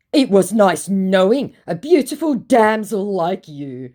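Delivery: high, slow, loud parody of gallantry; hammed-up romantic goodbye; mock flowery farewell. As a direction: mimic a theatrical suitor bidding farewell. Mocks his flowery farewell.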